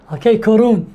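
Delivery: ordinary talk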